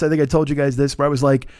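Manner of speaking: ordinary talk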